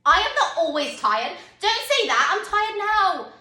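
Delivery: loud, high, defensive protest; exasperated retort. Acted. Tired parent answering child's complaint, exasperated.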